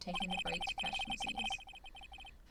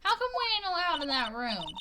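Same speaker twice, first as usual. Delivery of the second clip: put-on southern accent; 5 semitones higher, 7 dB louder than usual. Lotta greeting Phoenix in a loud Southern accent. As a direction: holler it out like a Southern country gal. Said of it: "impressive grasp in English".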